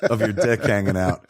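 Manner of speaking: normal talking voice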